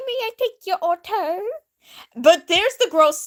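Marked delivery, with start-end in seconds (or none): 0.1-1.4 higher, playful pretend waiter voice; mock waiter. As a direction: play a fake tall waiter. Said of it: "He's like".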